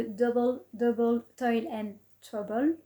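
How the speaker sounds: French speaker putting on an English accent; playful English accent on the English title. Shown as a demonstration. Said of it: "Avec mon superbe accent anglais".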